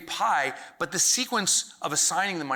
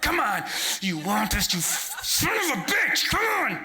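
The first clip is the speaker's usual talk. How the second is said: yelling at the tube; 6 semitones higher than usual. Retold or imitated. Straining at the toothpaste tube, shouting it on. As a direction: climb up high and shout at it. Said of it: "don't yell that in a hotel room".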